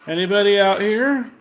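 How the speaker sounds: loud, high questioning call. Real, not acted. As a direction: call out to see if anyone answers. Calling out to unseen spirits.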